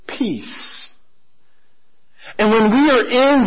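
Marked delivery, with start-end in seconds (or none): 0.1-0.9 peace breathed out in a whisper. Acted. Preacher ending the line, whispering peace. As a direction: let peace fade into a whisper.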